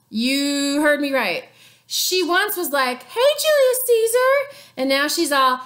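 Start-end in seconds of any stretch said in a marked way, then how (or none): 3.0-4.6 high, sing-song flirty greeting; high flirty hey boo; Cleopatra flirting. Retold or imitated. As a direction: coo it up high.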